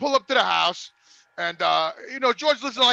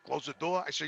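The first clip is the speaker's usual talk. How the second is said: usual speaking voice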